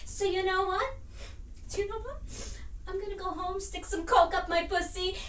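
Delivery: quiet, bitter sign-off; subdued defiance. Acted. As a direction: pull right back before the insult. Acted quarrel, subdued before final insult.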